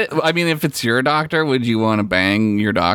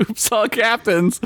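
high, giggly echo of the joke; cracking up while echoing the joke; laughing repeat; 10 semitones higher than usual. Acted.

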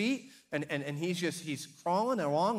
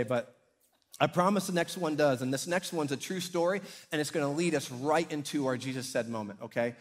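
ordinary delivery

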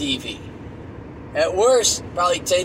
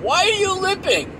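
much higher concerned mom; mock mom voice; 14 semitones higher than usual. Mom asking grandkid why he's limping, high and concerned. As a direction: ask like a worried mother. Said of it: "Mom would see him".

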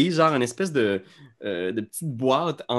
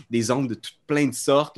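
ordinary delivery

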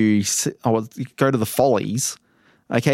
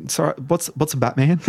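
ordinary delivery

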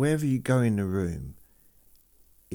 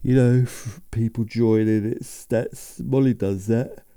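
words stretched higher by a yawn; 4 semitones higher, 5 dB louder than usual. Real, not acted. Podcaster listing admin jobs, talking through a yawn. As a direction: talk through a big yawn, pitched up.

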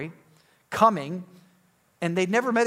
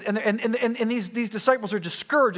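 normal talking voice

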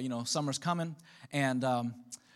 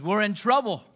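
loud, raised rallying cry; rallying call; 5 semitones higher, 9 dB louder than usual. Retold or imitated. Nehemiah warning the people of Jerusalem, rousing shout. Acted rallying speech, repeated.

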